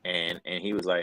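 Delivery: usual speaking voice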